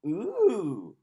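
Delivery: high loud grossed-out ooh; disgusted ooh. Acted. Family recoiling from the muck, disgusted ooh. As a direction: recoil at the smell. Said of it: "cried her mother and sister".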